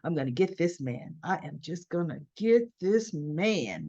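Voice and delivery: low, soft scheming murmur; hushed plotting, eyes on her prize; mock Diane plotting under her breath; scheming inner voice. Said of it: "gazing at Jack like".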